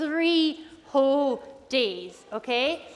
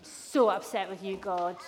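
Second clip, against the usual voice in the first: sulking rage; 6 semitones lower, 4 dB quieter than usual. Acted.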